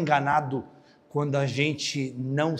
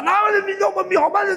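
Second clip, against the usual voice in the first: loud, high angry cry; the robbed man yelling in fury; retold furious victim; 5 dB louder than usual. Voz do argentino furioso. (argentino furioso gritando)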